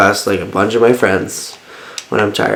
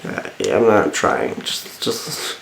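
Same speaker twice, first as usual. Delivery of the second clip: tired voice; 3 semitones lower, 8 dB quieter, much slower than usual. Retold or imitated. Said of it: "Your voice is really hot right now".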